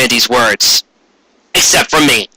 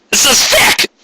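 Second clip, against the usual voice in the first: mock outraged aside; 13 semitones higher, slower than usual. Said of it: "Oh, God, I'm so sorry, people".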